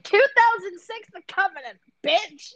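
high shouted boast; possessive claim. Retold or imitated. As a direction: claim him with attitude. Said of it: "I was like".

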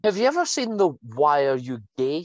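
usual speaking voice

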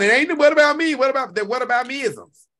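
high, loud mock whine; mock whining. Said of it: "there you go crying".

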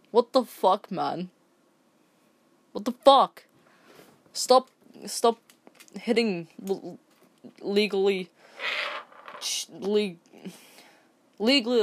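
furious disbelief; outraged. Real, not acted. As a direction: yell it in disbelief. Outraged at slapping.

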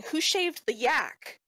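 high, drawn-out heckling cry; high-pitched yell. Acted. Game master as unseen heckler throwing a cabbage, high-pitched mock yell. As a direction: pitch it up and stretch the words. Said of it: "a high-pitched voice yelling".

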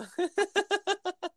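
high burst of laughter; laughing. Real, not acted. Laughing while telling the class story. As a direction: laugh at the memory.

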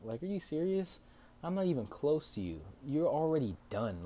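high-pitched exasperated objection; retold indignant protest. Retold argument with old lady.

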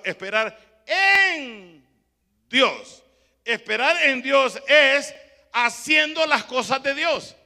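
each word of "esperar en Dios" drawn out slowly; slow emphatic. Shown as a demonstration. Preacher slowly spelling out the verse to church, drawn out. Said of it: "oiga lo que dice la palabra".

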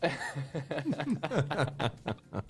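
quiet chuckling laugh; soft laugh. Real, not acted. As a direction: laugh softly to yourself. Laughing at quiz host.

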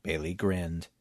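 ordinary delivery

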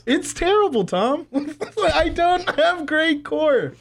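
high, laughing through the words; giggling while admitting it. Real, not acted. Laughing at her own clumsiness.

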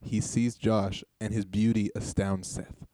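slow, measured narrator read; scene narration. Acted. Host setting the scene for two improvisers, slow narration. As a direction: narrate the action deliberately, slowly.

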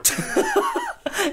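high, squealing real laugh; burst of laughter. Real, not acted. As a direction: cracks up at the player's line. GM laughs at player's jab.

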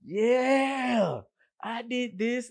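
high, loud victory yell; triumphant shout. Retold or imitated. Cook celebrating a busy shift with music on, triumphant shout. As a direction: shout it like a touchdown. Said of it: "I was like".

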